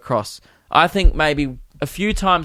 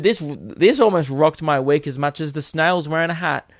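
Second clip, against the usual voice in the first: ordinary talk, nothing marked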